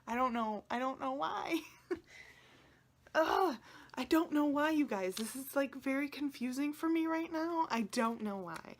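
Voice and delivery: high, loud, tearful bewilderment; overwhelmed and pleading, close to crying; about to cry. Real, not acted. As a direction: voice climbing as tears well up. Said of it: "I'm gonna cry".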